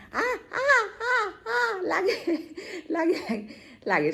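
high, loud cries of hurt; real high-pitched ow; pained yelping. Real, not acted. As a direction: yelp and protest that it hurts. Cries that it hurts.

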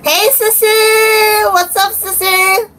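high, slow, sing-song "hey, sissy!"; excited greeting. Real, not acted. Kid greeting her sister excitedly on the live stream. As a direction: stretch it out like calling a sister.

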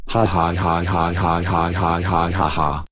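spelled-out sneaky ha ha laugh; mischievous laugh. Acted. Boy sneaking off to Chuck E. Cheese, sneaky laugh. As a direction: laugh like you're getting away with it.

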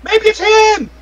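high-pitched accusing cry; alarmed doorman. Acted. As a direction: jump to a panicked accusation. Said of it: "He's like".

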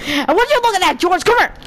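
high-pitched challenge, picking a fight; mock confrontation. Player turning on George in the game, high mock-aggressive challenge.

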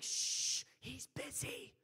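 loud hush, then forced stage whisper; shushing disciples. Acted. Preacher imitates disciples hushing the beggar, shushing. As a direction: shush him and stretch it out insistently. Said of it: "Can you imagine the disciples".

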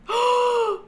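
high, loud startled gasp then exclaim; gasp, then a shocked high cry; gasping surprise. Real, not acted. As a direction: caught off guard, shocked. Sudden discovery of burn marks.

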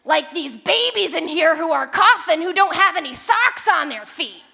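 loud, judgmental jab at a stranger; a sneering, exaggerated version of her; nasty old woman. Woman in the store mocking the mom, in a loud sneering voice. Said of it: "I say it in that voice to make her sound way worse".

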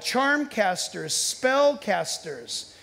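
each word drawn out slowly; slow emphasis. Acted. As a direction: spell out the terms with weight. Preacher lingering on key words.

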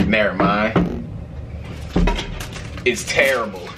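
usual speaking voice

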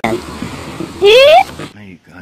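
high stretched effect noise; a slow, squealing mock sound effect; goofy drawn-out effect voice. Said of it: "That was a good sound effect".

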